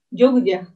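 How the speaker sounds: "Jogja" with the gu vowel barely sounded; Japanese Jogja. Shown as a demonstration. Teacher showing students how "Jogja" is read, Japanese style. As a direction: say "Jogja" as Japanese jo-gu-ja, u almost gone. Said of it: "Bu-nya itu, eh, kayak hilang gitu".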